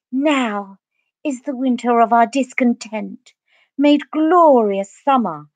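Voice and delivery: posh theatrical soliloquy with drawn-out final words; declaimed verse, plummy and overdone; hammed-up Shakespeare. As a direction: ham it up like a grand stage actor. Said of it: "I'd just ham it up".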